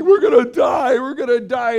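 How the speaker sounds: mock terror of drowning fishermen; imitating panic. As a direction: disciples in the storm, hammed-up panic. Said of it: "they're panicking".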